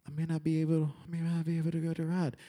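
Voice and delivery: small soft mock worry; near tears, retold. Short kid facing the height sign, softly near tears. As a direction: murmur it like a kid about to bawl. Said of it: "I am about to bawl".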